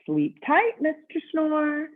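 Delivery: high, slow, sing-song goodnight; bellhop voice. Acted. Bellhop bidding Mister Snore goodnight, high and slow. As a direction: tuck him in like a cheerful bellhop.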